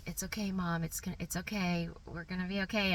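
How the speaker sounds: softly voiced imitation of a whisper; quiet reassurance. Daughter calming her panicking mom in a silent train, hushed. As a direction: reassure softly, keeping it under the surrounding talk. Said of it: "she's whispering this really quietly".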